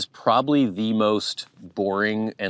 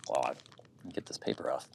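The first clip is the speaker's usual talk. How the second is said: whispered under the breath; very soft, whispery self-talk; muttered aside; 7 semitones lower, 13 dB quieter than usual. Real, not acted. Speaker to self getting paper off candy, whispered aside.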